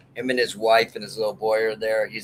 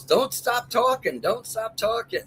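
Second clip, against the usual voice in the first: high, slow mock girl's plea; imitating the girl; 12 semitones higher than usual. Retelling the Daytona Beach girl begging the British guy, high mock girl voice. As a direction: pitch way up and draw it out like the smitten girl. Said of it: "she kept goes".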